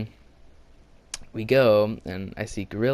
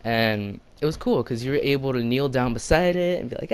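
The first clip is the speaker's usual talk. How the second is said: ordinary delivery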